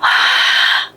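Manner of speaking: long voiceless sigh; audible exhaled sigh, acting out a reaction; retold sigh. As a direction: let out a heavy breath. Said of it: "Jadi aku kayak".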